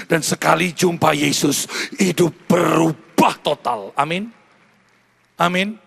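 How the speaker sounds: loud, slow proclaiming preacher; full-force preaching. Real, not acted. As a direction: drive it home to the congregation, loud. (khotbah berapi-api dengan suara keras)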